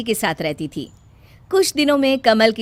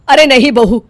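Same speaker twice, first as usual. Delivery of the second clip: loud, firm no; stern refusal; 8 dB louder than usual. Acted. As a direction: shut her down flatly. Mother-in-law refuses bride.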